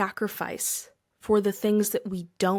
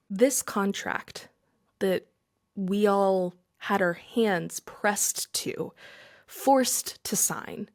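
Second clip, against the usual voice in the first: drawn out, word by word; slow right down to make it sink in; much slower than usual. Acted. Stressing the false contract.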